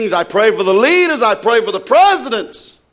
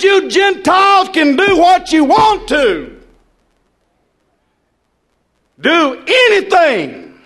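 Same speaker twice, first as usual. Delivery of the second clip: God thundering; 7 semitones higher than usual. Acted. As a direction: proclaim it as God from above. God's words voiced in sermon.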